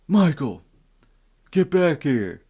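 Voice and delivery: high, loud summons; imitated dad calling. Dad summons son from bedroom, imitated angry call. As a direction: call out sharply like a fed-up parent.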